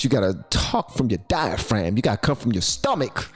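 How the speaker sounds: normal talking voice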